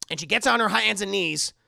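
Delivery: normal talking voice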